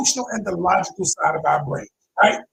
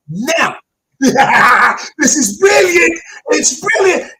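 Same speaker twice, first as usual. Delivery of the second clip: loud, high, laughing delight; laughing excitement; 6 semitones higher, 7 dB louder, much slower than usual. Real, not acted. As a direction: crow with joy over the method. Coach thrilled with his method.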